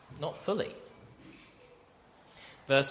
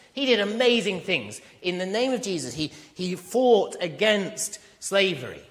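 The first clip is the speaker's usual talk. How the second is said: raised, emphatic sermon voice; preaching; 6 semitones higher than usual. Acted. Preacher to his church, raised and emphatic.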